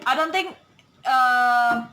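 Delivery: ordinary talk, nothing marked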